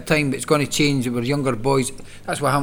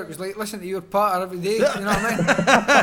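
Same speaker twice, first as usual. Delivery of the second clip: fast, higher laughing dig at a friend; laughing jab; 6 semitones higher than usual. Real, not acted. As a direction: tease him back through a laugh. Banter, laugh heard.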